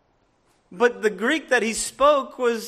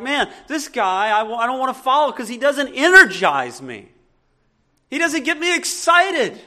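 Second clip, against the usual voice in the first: louder, mock-grumbling listener with a laugh; bored listener complaint; 2 semitones higher, 7 dB louder than usual. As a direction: whine like an unimpressed crowd member, laughing. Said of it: "people are like".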